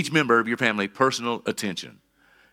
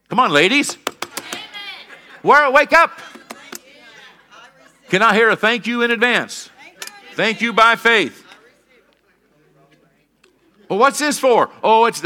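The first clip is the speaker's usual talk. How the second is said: loud, high call to the congregation; rousing preacher shout; 11 semitones higher, 8 dB louder than usual. Real, not acted. Preacher prods the ladies to respond, loud rousing shout. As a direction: holler to rouse a sleepy crowd.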